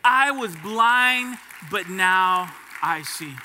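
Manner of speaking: loud, slow proclamation; preaching climax. Acted. Preacher proclaiming to the congregation, loud and slow. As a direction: proclaim it to the whole church.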